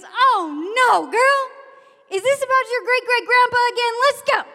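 high, loud, rapid-fire disbelief; exasperated scolding. Retold or imitated. Mister Jones facing the same fight, loud exasperation. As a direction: cut the drama off loudly and briskly.

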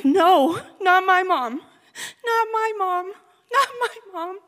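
high, loud wailing cry; a daughter wailing at her mother's death; crying denial. Retold or imitated. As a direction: sob it out over and over. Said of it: "I scream".

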